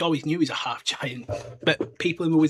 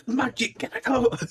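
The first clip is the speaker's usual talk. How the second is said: mock high squeal; 9 semitones higher than usual. Mock stomping out magic.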